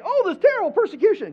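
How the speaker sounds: high, loud mock lament; exaggerated high-pitched complaint; wailing crowd. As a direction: cry it out like a panicked crowd. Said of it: "everybody was saying".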